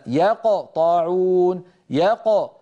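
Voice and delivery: يقطعون with the ق chopped off abruptly, very slow; cut-off qalqala. Shown as a demonstration. Teacher imitating tutors who cut off the qalqala. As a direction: break يقطعون sharply at the ق. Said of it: "يبتر صوت القلقلة".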